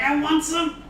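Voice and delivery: high-pitched mock dog voice, greedy; Gizmo the dog. Owner voicing Gizmo the dog who smells the steak, high mock dog voice. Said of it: "He's like".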